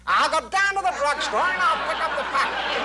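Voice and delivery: the actor caught the voice and parrots it back; actor copying Smart voice. Retold or imitated. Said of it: "everybody was talking like that".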